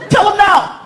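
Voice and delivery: very high, insistent order; mock mom who means it; commanding mother. Girlfriend demands he answer son, commanding high yell.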